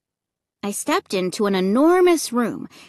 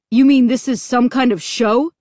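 louder, disbelieving question; demanding; 3 semitones higher, 5 dB louder than usual. A narrator's reading. Dad questioning the MC about the show, demanding. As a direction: demand an explanation. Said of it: "Dad demanded, frowning".

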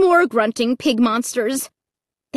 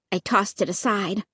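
ordinary delivery

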